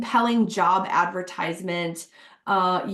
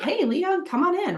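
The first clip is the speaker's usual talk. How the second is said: bright, much higher hello to a new hire; cheery welcome; 9 semitones higher than usual. Retold or imitated. Boss welcoming new hire Leon, cheerfully high. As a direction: greet him like you're thrilled he's here.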